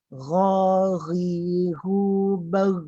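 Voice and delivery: GHARIRU slow and long, BAR closed without an echo; no bounce. Shown as a demonstration. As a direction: chant it and stop bar dead. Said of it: "tidak ada pantulan". (bacaan panjang, bar mati tanpa pantulan)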